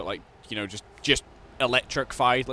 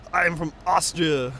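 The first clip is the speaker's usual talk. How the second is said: slow, higher put-on Arnold; 5 semitones higher, 4 dB louder, slower than usual. Arnold answers his future self, slow put-on voice. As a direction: drawl it out like Arnold. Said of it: "He goes".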